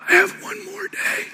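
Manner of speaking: imitating a dying father's high strained voice; strained faint voice. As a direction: force each word out with failing breath. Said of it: "My dad would talk like this".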